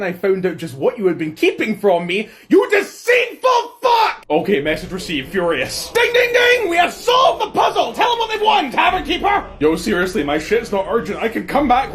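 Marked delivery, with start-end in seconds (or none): 2.5-4.2 high, loud, slow outburst of rage; furious shout. Acted. Monarch screaming at the deceitful spy master. 5.9-9.5 gleeful shout. Acted. Gleeful announcement.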